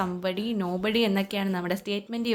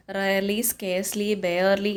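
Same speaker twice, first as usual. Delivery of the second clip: slow listing; much slower than usual. Shown as a demonstration. Teacher lists words slowly.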